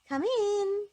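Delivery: high, loud call from another floor; calling from upstairs. Acted. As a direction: call out so they hear you downstairs. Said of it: "called a voice from upstairs".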